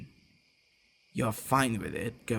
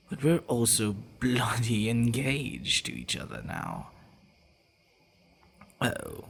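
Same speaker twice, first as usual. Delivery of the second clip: normal talking voice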